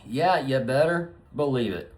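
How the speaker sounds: normal talking voice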